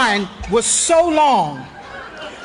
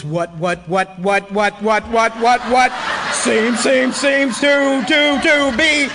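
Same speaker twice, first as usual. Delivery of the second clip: stammering cop, drawn out at the end; a lightly voiced cop stuck on his words; stuttering cop; slower than usual. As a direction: stutter it like a tripping hallucination. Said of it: "he starts talking like this".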